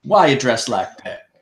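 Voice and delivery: loud brash lawman demand; gruff lawman. Acted. Russet confronting the wounded marshal, loud and brash. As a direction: bark it out like a gruff sheriff. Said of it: "and he says".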